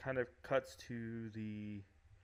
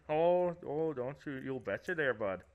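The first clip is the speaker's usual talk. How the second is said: hammed-up Upper Midwest accent, a touch higher; Michigan accent; 4 semitones higher, 5 dB louder than usual. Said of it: "That's how we do it here in Michigan there".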